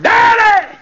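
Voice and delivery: high, loud cry for a father; acted daughter's desperate shout; screaming and crying. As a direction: scream out for Daddy. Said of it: "she was screaming and crying".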